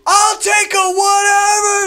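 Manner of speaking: high, stretched-out yell; screamed drive-thru order. Retold or imitated. Next customer has to order over blasting bass, screaming. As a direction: bellow it slowly over booming music. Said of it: "has to scream, like".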